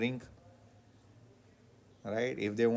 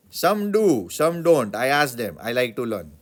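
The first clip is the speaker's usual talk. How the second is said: loud eager reply; 9 dB louder than usual. Acted. Acted reply in dialogue.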